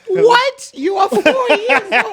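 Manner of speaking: loud, very high, drawn-out disbelief; high, incredulous mock scolding; mock outraged parent. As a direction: squeal it in exaggerated disbelief. Acted reaction to British-accented child.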